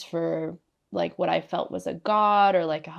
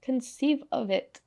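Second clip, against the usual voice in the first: higher-pitched imitation of a French accent; godmother's French accent; 7 semitones higher than usual. Godmother's words from the other side, in French accent. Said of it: "she kept saying in her accent".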